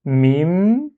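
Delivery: "mim" drawn out on a long final m; first syllable held. Shown as a demonstration. Teacher reading the first syllable alone, long "mim". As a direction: hold the closing m of "mim". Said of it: "Et on lit mim".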